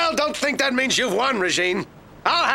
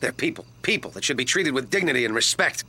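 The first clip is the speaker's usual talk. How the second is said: sincere apology; 13 semitones lower, 4 dB quieter than usual. Acted.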